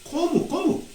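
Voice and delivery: "Como?" jumped high, soft and incredulous; indignant high "Como?". Shown as a demonstration. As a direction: squeak "Como?" up high in disbelief. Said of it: "Eu, eu tô indignado". ("Como?" bem agudo, indignado)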